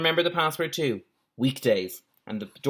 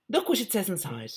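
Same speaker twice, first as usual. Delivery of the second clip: put-on posh girl voice; 8 semitones higher, 4 dB quieter than usual. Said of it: "She's gotten posher as well".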